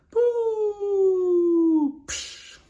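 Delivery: falling whistle and splash. Acted. Host showing a fall into the lake, imitated drop.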